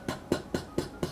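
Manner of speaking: "p" as soft, voiceless puffs of air, repeated; just the "p" puff, lips popping, no voice; p sounds. Shown as a demonstration. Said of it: "make sure you got the p".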